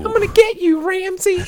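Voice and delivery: high, strained mock villain growl; mock Undertaker voice. Mocking an actor's failed Batman voice, high strained villain. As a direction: ham up a wrestler-villain threat. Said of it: "Undertaker in, uh, Suburban Commando".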